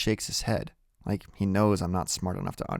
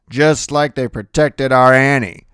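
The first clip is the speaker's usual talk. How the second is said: loud, higher-pitched sarcasm; grandfather's bitter, raised voice; bitter retort; 5 semitones higher, 10 dB louder than usual. A narrator's reading. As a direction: throw it back bitterly.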